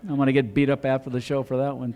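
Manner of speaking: ordinary talk, nothing marked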